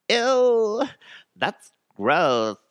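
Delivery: put-on loud, high "ew" in valley girl disgust; exaggerated valley girl grossed out; teenage valley girl. Host shows off his impression after naming it, teenage valley girl. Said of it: "that's my teenage valley girl".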